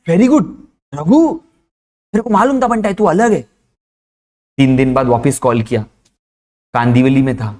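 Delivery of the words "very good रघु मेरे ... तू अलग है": impressed praise. Acted. Cheering on friend's banner idea.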